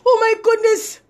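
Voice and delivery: high-pitched imitation of an aghast teacher; shocked teacher. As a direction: mimic his shock, pitched well up. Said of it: "he was shocked too".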